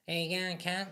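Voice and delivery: high, broad Australian "Hey, you going"; mock Aussie accent. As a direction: tease with a lightly put-on Australian accent. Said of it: "You don't really have, like".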